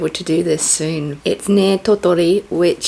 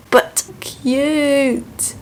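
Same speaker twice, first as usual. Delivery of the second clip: high cute squeal; 7 semitones higher than usual. Real, not acted. Showing off cheap Lego.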